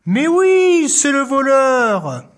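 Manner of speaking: high, loud, drawn-out reveal; spring the surprise on the kids, slowly; dramatic reveal. A narrator's reading. Storyteller to children, a big slow reveal.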